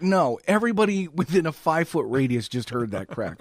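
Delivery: normal talking voice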